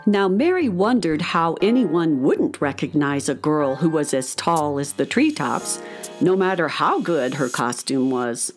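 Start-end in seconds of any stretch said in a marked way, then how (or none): none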